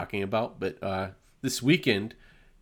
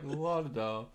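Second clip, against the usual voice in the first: mock chill; 2 semitones higher, 8 dB quieter, slower than usual. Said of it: "all chill about".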